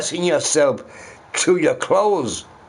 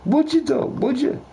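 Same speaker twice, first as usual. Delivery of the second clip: sharp high-pitched challenge; incredulous challenge; 12 semitones higher than usual. Real, not acted. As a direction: leap way up in disbelief. Emphatic rhetorical challenge.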